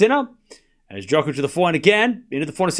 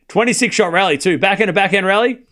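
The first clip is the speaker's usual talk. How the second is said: usual speaking voice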